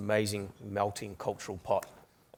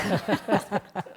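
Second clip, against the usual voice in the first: bright high laugh, a bit louder; real laugh; 12 semitones higher, 8 dB louder than usual. Real, not acted. Panelist laughing after forgetting a name, real laugh. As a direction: laugh at your own forgetfulness.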